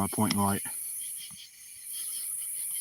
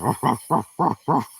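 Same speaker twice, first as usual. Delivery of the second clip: barking like a dog; 2 semitones higher, 7 dB louder than usual. Pretend. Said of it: "Gonna start barking too".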